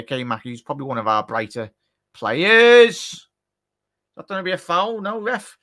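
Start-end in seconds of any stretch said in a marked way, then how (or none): 2.1-3.0 word jumps much higher and louder; sudden alarm. Real, not acted. Fan reacts mid-sentence to danger, much higher and louder. 3.1-5.6 higher pitched protest; appealing to ref. Real, not acted. Fan protesting at the referee on screen, higher pitched.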